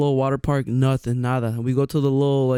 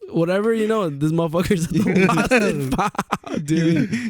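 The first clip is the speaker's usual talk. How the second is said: higher, words breaking into laughter; laughing while talking; 4 semitones higher, slower than usual. Real, not acted. Friend tells of finding the kid in lost and found, laughing. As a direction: tell it through real laughter, pitched up.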